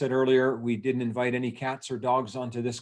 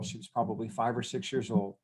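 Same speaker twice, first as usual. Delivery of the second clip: ordinary talk, nothing marked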